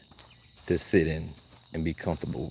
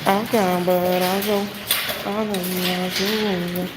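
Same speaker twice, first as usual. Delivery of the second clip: put-on baby talk; 11 semitones higher, 4 dB louder, much slower than usual. High baby-talk to dogs.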